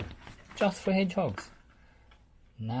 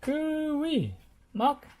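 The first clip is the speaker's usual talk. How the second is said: very high squeaky hello; squeaky call; 5 dB louder, much slower than usual. Acted. Dizzy squeaking a greeting to Muck. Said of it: "squeaked Dizzy".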